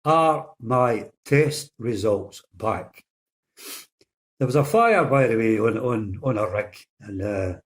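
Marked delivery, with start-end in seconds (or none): 0.0-3.0 very slow, deliberate question; patient slowly spelling out the question to the nurse. Retold or imitated. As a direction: spell it out slowly so she finally hears. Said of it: "but listen very closely".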